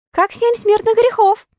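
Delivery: high, cheeky puppet voice; Pinocchio laughing. A narrator's reading. Pinocchio mocking the boys, high laughing retort. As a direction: toss off the joke with a laugh. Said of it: "расхохотавшись".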